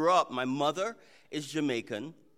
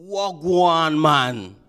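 relaxed Jamaican patois hello, a little louder; Jamaican greeting; 9 dB louder, much slower than usual. Shown as a demonstration. Pastor showing the congregation a Jamaican hello, Jamaican accent. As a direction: greet them the easy Jamaican way. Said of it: "they will usually greet you".

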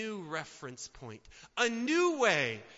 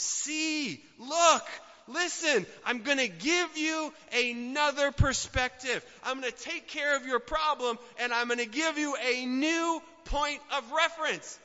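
raised, insistent voice of God; God's voice, emphatic; 6 semitones higher than usual. Acted. God telling Isaiah, firm and raised. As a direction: call out boldly as God would. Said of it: "God says".

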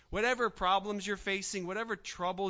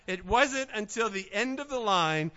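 ordinary delivery

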